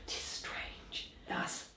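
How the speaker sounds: breathy night-watch whisper; hushed whisper. Acted. Guard whispers to comrades about the ghost, hushed.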